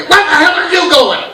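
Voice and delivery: loud, high mock outrage; angry dad. Dad yells at son walking away, angry. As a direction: yell after a departing kid. Acted dad calling kid back.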